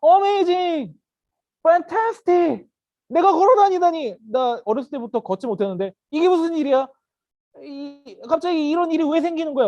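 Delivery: high, loud astonished exclaiming; amazed. Acted. Healed beggar who suddenly walks, amazed. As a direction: burst out in hammed-up amazement. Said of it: "이 사람은 무슨 말을 했을까요".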